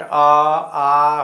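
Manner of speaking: अ, आ slow, each vowel drawn out from the throat; pronunciation demo. Shown as a demonstration. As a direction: say अ, आ slowly and let the vowels ring from the throat. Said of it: "इसको आप उच्चारण करके भी देख सकते हैं". (अ, आ को धीरे-धीरे, गले से खींचकर बोलिए)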